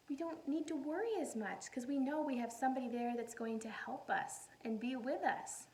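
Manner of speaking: ordinary delivery, nothing marked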